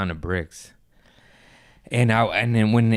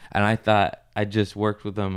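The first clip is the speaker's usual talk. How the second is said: ordinary talk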